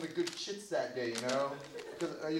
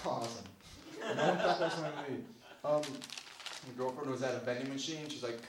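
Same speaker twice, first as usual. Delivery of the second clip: ordinary delivery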